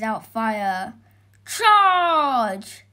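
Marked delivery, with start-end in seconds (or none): 1.4-2.8 high, loud drawn-out charge; battle cry. A narrator's reading. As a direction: cry out to attack as a knight. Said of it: "cried George".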